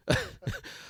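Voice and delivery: a short, high, airy burst of laughter; high breathless laugh. Real, not acted. Host laughing at the Hitler mix-up, high airy laugh.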